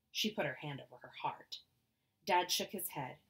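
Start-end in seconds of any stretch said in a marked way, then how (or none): none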